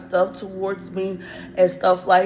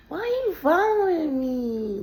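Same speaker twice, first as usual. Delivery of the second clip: high, slow sneering mimic; mock her taunting question; mocking imitation; 13 semitones higher, slower than usual. Imitating the girl mocking her on the escalator. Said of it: "she was mocking me back".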